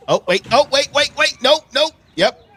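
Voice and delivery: loud, high, staccato warnings; alarmed reaction. Real, not acted. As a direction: watch it go wrong, word by word. Reacting live to runaway trailer clip.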